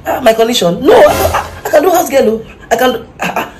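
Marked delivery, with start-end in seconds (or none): 0.1-2.3 high flustered laughing protest. Acted. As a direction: laugh it off nervously.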